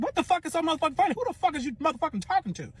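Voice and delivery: high-pitched, outraged retelling; high, incredulous challenge; indignant demand. Retold or imitated. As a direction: act out a fired-up confrontation. Acted angry confrontation of laughing boyfriend.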